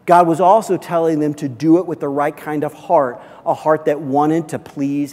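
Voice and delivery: loud, driving sermon delivery; preaching. Acted. Pastor preaching hard to his congregation, forceful.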